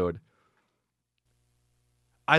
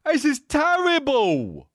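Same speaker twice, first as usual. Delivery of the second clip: slow Ramsay verdict; 7 semitones higher, slower than usual. Retold or imitated. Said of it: "Gordon Ramsay's like".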